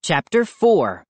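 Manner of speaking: plain reading voice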